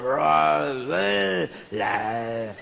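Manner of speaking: a silly roar then sung nonsense; mock dinosaur roar. Jesus pretending to be a dinosaur, hammed-up roar. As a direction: ham up a dinosaur to blend in. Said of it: "trying to blend in".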